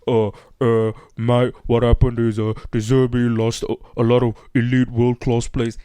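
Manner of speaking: imitating a Geordie YouTuber, a Geordie accent pitched low; True Geordie accent. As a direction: slip into a hammed-up Geordie accent for a pundit bit. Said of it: "I'm gonna do my True Geordie accent".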